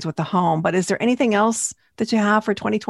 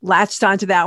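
normal talking voice

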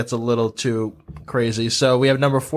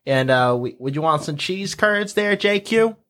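Minnesotan accent. Shown as a demonstration. Local chatting about family and cheese curds, Minnesotan accent. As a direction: put on a folksy Minnesotan accent. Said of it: "they can all go back to speaking like".

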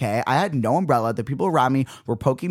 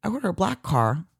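ordinary talk

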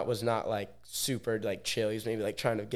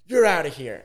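loud, higher-pitched mock ejection; retold barked order; 6 semitones higher, 9 dB louder than usual. Security throwing out the dance-floor filmer, loud retold order. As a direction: throw him out in one raised-voice burst. Retelling bouncer throwing him out.

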